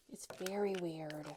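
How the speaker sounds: whispered repeat of "very weird". Real, not acted. Aside to viewers about the car, whispered. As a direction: say it again under your breath.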